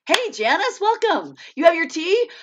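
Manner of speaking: excited greeting. Real, not acted. Welcoming Janice to the live chat, high and excited. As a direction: greet her warmly and eagerly.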